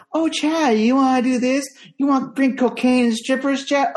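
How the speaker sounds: high, coaxing mock kid voice; mock power suggestion. Mother mocking how kids talk Chad into things, high coaxing voice. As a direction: parody a kid's leading question. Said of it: "give you that power suggestion".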